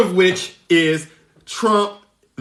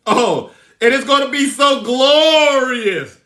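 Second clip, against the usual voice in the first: gloating; 5 semitones higher, 4 dB louder than usual. Real, not acted. Gloating over coming indictment.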